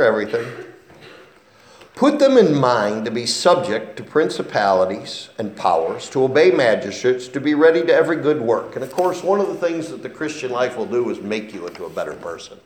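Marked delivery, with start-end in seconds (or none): 1.9-8.7 louder, measured reading of the verse; formal Bible reading; scripture reading. Acted. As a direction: read the verse out to the congregation. Reads scripture aloud.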